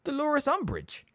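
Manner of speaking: high slow puzzled repeat; baffled echo of the name; confused. Acted. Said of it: "sounding thoroughly confused".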